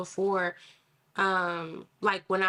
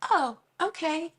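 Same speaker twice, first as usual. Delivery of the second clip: put-on high, slow, unbothered little girl; child voice; 8 semitones higher, slower than usual. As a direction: shrug it off like a kid. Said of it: "And she goes".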